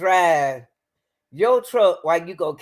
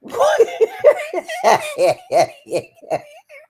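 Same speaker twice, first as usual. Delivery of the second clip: high burst of real laughter; loud helpless laugh; big laugh; 5 dB louder than usual. Real, not acted. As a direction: crack up hard. Reacting to courtroom footage.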